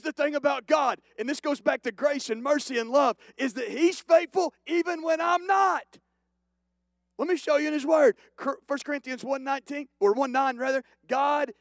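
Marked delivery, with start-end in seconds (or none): 3.4-8.2 higher and louder, shouting the point; full-force preacher's shout; preaching at full force. Real, not acted. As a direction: build to a pulpit cry. Preaching climax.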